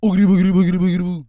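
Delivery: loud, fast cartoon spook babble; high, hammed-up bogeyman chant; mock spooky ghost. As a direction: rattle it off like a costumed Scooby-Doo villain. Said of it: "in a fake-ass Scooby-Doo costume talking about".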